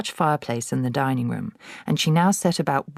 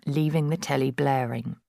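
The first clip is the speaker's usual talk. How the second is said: plain reading voice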